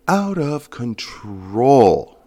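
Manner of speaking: "out of control" drawn out slow and high; emphatic drawl. Real, not acted. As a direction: stretch "out of control", especially "control". Exaggerates deer population for emphasis.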